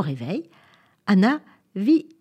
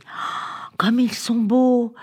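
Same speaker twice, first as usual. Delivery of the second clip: hushed gasp, then higher, drawn-out admiration; admiring wonder; 5 semitones higher, much slower than usual. A narrator's reading. Anna admiring the orangutan and chimpanzee, full of wonder. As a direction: breathe the oh, then linger on the praise.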